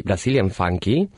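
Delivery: ordinary talk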